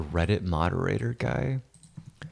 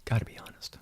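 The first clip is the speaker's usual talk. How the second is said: unvoiced confiding whisper; 5 dB quieter, faster than usual. Real, not acted. Admitting to viewers about Amy, a whisper. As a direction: hush it like a confession.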